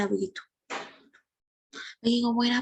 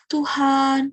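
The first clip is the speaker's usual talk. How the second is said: acted sobbing call, louder and drawn out; tearful prayer; 3 semitones higher, 6 dB louder than usual. Hana praying to God while weeping, acted tearful plea. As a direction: cry out to God with mock sobs. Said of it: "berdoa kepada Tuhan sambil menangis".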